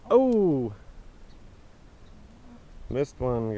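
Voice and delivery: normal talking voice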